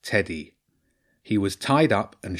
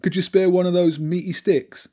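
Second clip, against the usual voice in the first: high-pitched begging rat; Bobby; 9 semitones higher, 4 dB louder than usual. Acted. Rat begs children for dog treats, high hopeful plea.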